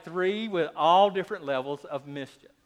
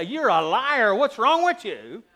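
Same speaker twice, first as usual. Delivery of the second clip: a louder mock accusation of the child; mock scolding; 5 semitones higher, 9 dB louder, slower than usual. Parents scolding a lying daughter, mock accusing tone. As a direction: snap at the child as the bad example. What parents did not say.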